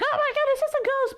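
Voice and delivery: high, frightened cry of "ghost". Retold or imitated. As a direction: shriek it out in sudden fright. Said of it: "They're like".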